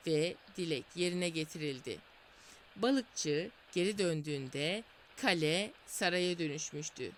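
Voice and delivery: ordinary delivery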